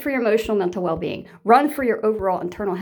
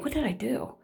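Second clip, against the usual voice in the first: soft, wondering inner question; quiet self-doubt; 3 semitones higher, 9 dB quieter than usual. Retold or imitated. Victim quietly wondering what they did, soft voice. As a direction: ask yourself quietly. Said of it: "you think".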